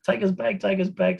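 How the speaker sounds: high, yelled plea to stop a diving plane; scared passenger yelling up high; panicked shout. Retold or imitated. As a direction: shout it in mock terror at the pilot. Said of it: "So we're terrified".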